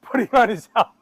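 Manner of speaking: real laughter breaking into the words; laughing. Real, not acted. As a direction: struggle to tell it through helpless laughter. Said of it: "I'm laughing".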